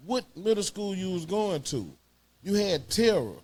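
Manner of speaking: normal talking voice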